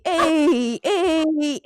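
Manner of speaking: normal talking voice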